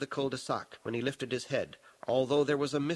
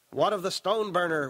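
higher-pitched, raised call; restrained shout from a newcomer; shouted question; 5 semitones higher, 4 dB louder than usual. A narrator's reading. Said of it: "one of the newcomers shouted".

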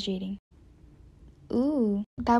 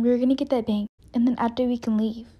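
ordinary talk

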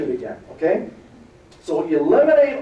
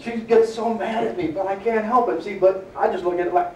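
ordinary talk, nothing marked

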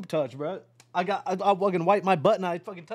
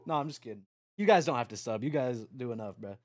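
usual speaking voice

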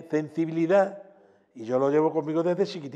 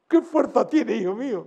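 higher, astonished old voice, imitated; amazed exclamation; 6 semitones higher than usual. Guitarist retelling an old singer's amazement, imitated high astonishment. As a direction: marvel at the strength, retold. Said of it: "me decía".

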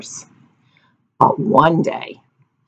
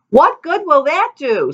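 doubtful villagers; 11 semitones higher than usual. A narrator's reading.

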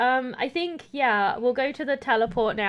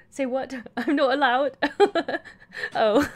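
ordinary talk

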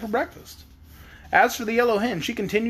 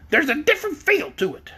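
high-pitched hen voice; high, sure-of-itself hen; insistent hen; 9 semitones higher than usual. A narrator's reading. Hen insists it was metal.

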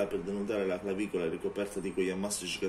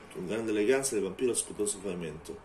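normal talking voice

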